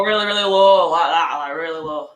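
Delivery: low, thick northern accent; deep northern voice. Shown as a demonstration. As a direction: drop the voice really low, northern. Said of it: "People go very low when they do the northern accent".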